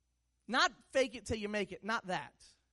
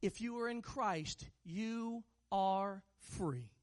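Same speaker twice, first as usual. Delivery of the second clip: very slow, word-by-word delivery; deliberate emphasis; 2 semitones lower, much slower than usual. Real, not acted. Preacher asking church to listen closely, slow and deliberate. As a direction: space out "you are free", letting each word land. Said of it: "Listen to me closely".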